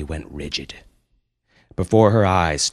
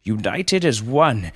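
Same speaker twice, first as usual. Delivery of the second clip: slow, raised, grand oratory; yelled proclamation; 7 semitones higher, 4 dB louder than usual. Acted. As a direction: proclaim it to a vast crowd as a noble panda. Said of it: "he yelled".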